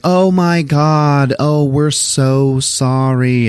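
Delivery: high, slow, drawn-out mock remorse; mock gushing apology. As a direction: overplay the horrified apology, stretching each word. Mimics guests apologizing for breakage.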